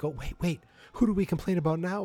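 high, fast mock panic; squeaky fan looking for someone to blame; mock panicked fan. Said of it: "You're like".

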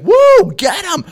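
high, loud whoop; mock crowd holler; imitated crowd cheering. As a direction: egg on a fight like a rowdy audience. Imitates cheering crowd egging him on.

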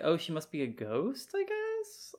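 rising high to a squeaky question; puzzled guess that climbs sharply at the end; mock bafflement. Co-host retelling her confused guess about the woman, mock bafflement.

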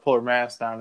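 normal talking voice

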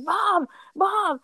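high, excited call to Mom. Retold or imitated. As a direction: shriek for Mom like a tattling kid. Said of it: "She was laughing".

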